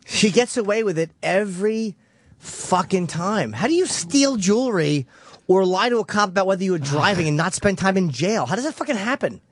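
high indignant disbelief; outraged. Real, not acted. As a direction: rail at the injustice, voice climbing high. Outraged rant about actress.

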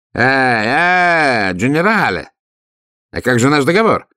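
громче и выше, тревожно вслед; окликнуть уходящего с нарастающей тревогой; кричит с беспокойством. A narrator's reading. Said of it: "закричал мне вслед со всё возрастающим беспокойством". (граф кричит вслед уходящему генералу, встревоженно)

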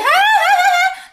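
high eerie cackling laugh; ghost's eerie high cackle in the night; ghostly laugh. Acted. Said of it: "ổng cười hả hả hả".

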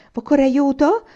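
una serva, acuta e forte; voce flautata. A narrator's reading. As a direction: squittisci l'offerta con voce flautata. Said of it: "con voce flautata". (una serva a Tamà, voce flautata acuta e forte)

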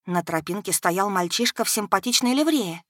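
ordinary delivery, nothing marked

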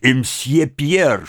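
ordinary delivery

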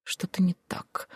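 soft, slow murmur; muttering. A narrator's reading. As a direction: murmur it slowly under your breath. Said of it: "пробормотал он". (тихо пробормотать)

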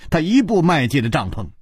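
usual speaking voice

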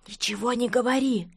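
ordinary delivery

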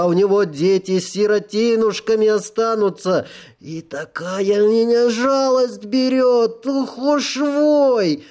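wailing tipsy self-pity; drunk sinner voice. Acted. Drunk sinner soul confesses.

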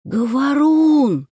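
«Говорун!» сипло тянет с набитым ртом; протяжно зовёт, давясь блином; шёпотом, давясь. Acted. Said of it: "давясь шёпотом". (давясь, шёпотом тянет «Говорун!»)

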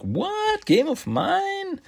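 high-pitched, drawn-out disbelief; exaggerated incredulous question; mock incredulous. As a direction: squeak it out as if wrongly accused. Acts Red King's indignant reply.

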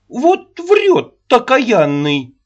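put-on very high, loud scolding old woman; shrill nagging grandma. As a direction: cry out at him like an angry nanny. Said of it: "Опять не утерпела Аннушка".

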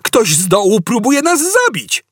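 high, thrilled outburst; yelled excitement. A narrator's reading. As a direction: cry it out at high pitch. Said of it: "wrzasnął".

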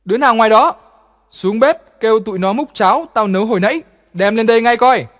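loud call to the servants outside; bossy shout to the servants. A narrator's reading. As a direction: shout an order out the door. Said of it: "đã gọi vọng ra ngoài".

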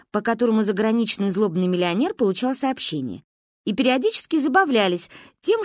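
plain reading voice

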